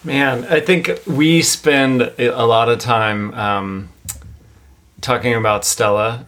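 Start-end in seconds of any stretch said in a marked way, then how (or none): none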